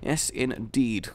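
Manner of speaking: ordinary talk, nothing marked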